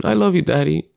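lightly voiced young son, affectionate; imitating his son. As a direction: son running up to his dad, gently voiced child. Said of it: "running up to me saying".

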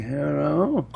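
lightly voiced frail old man, drawn out; a hint of a doddering grandpa picking up the phone. Answering a telemarketer as a lightly voiced old man. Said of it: "I like to answer as an old person".

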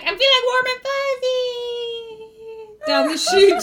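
high, long-drawn falling cry; falling goblin wail. Acted. Goblin falls into the hole, high drawn-out wail. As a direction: stretch the last word as you plummet. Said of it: "he fell forward into the hole ... he's like".

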